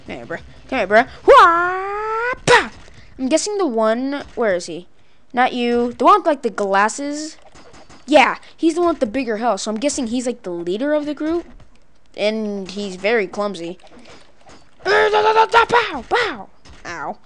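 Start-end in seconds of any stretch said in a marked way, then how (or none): 1.3-2.7 high, loud wail then a sharp bah; kung fu cry. Pretend. Player attacking in game, mock martial-arts cry. 14.8-16.5 high-pitched repeated impact cries; mock punch noises. Player throwing punches in game, playful strike sounds.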